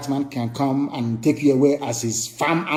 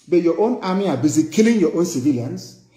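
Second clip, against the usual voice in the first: ordinary talk, nothing marked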